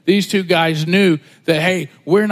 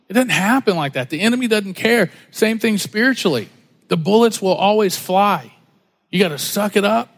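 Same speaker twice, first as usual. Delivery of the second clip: ordinary delivery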